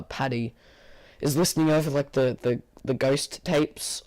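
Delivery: ordinary talk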